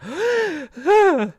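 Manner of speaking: loud, high mock-shocked gasp; exaggerated gasp. Streamer hinting at hunches about updates, big theatrical gasp. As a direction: gasp hugely, as if scandalised.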